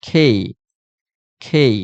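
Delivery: "খেই" with a breath after the k; aspirated K. Shown as a demonstration. Teacher drilling the corrected K, "খেই" aspirated each time. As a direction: say "খেই" as kh-ay, gliding into i. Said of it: "এটার উচ্চারণটা হবে কি?".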